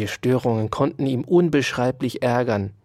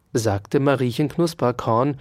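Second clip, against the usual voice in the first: ordinary delivery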